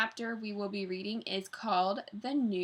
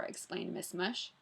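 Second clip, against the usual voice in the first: ordinary delivery, nothing marked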